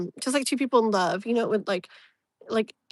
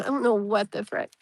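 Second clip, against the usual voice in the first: ordinary delivery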